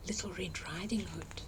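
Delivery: soft, secretive reveal; whisper. A narrator's reading.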